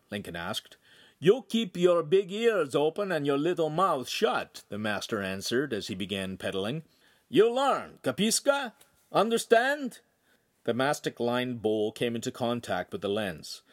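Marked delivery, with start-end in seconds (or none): 1.2-10.0 Italian master. Acted. Master scolding in accent.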